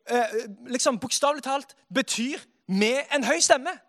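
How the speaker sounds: usual speaking voice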